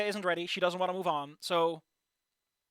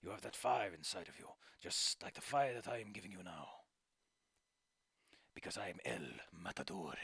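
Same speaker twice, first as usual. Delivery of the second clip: low and soft, sinking into a whisper; soothing, to a whisper; 10 semitones lower, 11 dB quieter than usual. Acted. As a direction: hush it like a secret blessing. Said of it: "his once bombastic tone is now more soothing".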